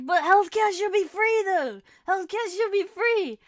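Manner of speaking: high, loud mock outrage; shocked protest. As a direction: plead it loudly, scandalized. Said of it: "I would understandably be shocked".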